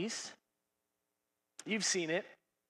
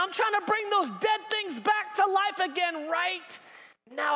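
preaching shout; 7 semitones higher than usual. Real, not acted. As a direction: build to a shout. Preaching climax.

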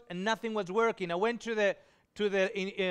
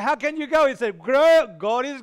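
louder and higher, exclaiming; excited exclamation; 4 semitones higher, 9 dB louder than usual. Retold or imitated. Proclaiming to the lady that God provided, loud and high. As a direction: burst out with it.

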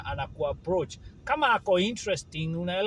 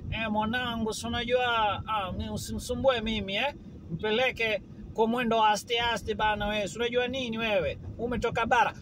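lightly put-on Kenyan Coast Swahili accent, easygoing; a mild imitation of a Coast local teasing someone from upcountry; 3 semitones higher than usual. Speaker imitates a Coast local brushing off an upcountry visitor, light Coast accent. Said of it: "tuseme kama Coast".

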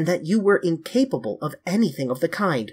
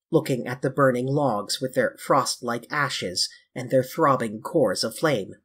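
usual speaking voice

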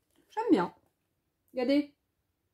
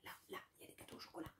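whisper; 28 dB quieter, much faster than usual. Acted. Whispering about chocolate cakes.

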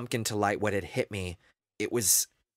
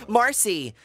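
high, loud cry of a name; desperate shout; 10 semitones higher, 5 dB louder than usual. Acted. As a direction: call after her in panic. Friend dragged underground.

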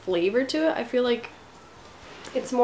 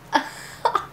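short giggle; 9 semitones higher than usual. Real, not acted. Looking at stained tongue.

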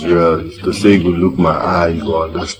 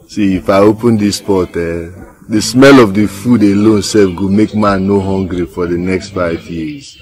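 ordinary talk

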